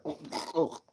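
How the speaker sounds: imitated grunts of a punched gut; winded groan. Streamer acting a dolphin's punch in the stomach, winded grunts. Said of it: "you'll be like".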